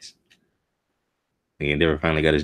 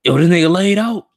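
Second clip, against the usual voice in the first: shocked shouting; 7 dB louder than usual. Real, not acted. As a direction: voice shooting very high and loud in disbelief. Reacting to hero being beaten.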